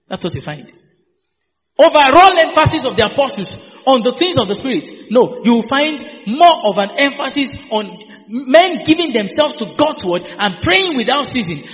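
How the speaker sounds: ordinary talk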